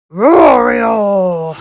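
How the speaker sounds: loud drawn-out bear roar. A narrator's reading. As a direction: roar big to scare the goose. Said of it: "repeated the bear".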